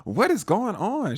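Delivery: high, excited squeal of delight; happy surprise. Acted. Victoria hearing the cocktail order, happily surprised. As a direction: gush in a very high voice, stretching the last words. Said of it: "happy surprised, like".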